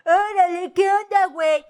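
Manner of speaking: high, hammed-up Mexican accent; mocking Mexican accent. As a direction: mimic foreigners overdoing Mexican slang. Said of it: "we don't speak like that".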